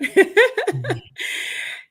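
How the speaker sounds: long high delighted laugh; happy laughter trailing off; real laugh. Real, not acted. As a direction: laugh freely, charmed. Laughing after the hug.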